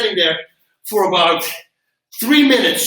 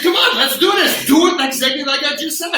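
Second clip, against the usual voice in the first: higher, louder coaching call; rally them back to work; pushing the class; 8 semitones higher, 3 dB louder than usual. Retold or imitated. Instructor hiding his pain, loudly urging the class on. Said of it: "I said".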